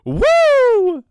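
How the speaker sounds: high, loud, piercing Ric Flair woo. Retold or imitated. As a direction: belt it out like Flair on the top rope.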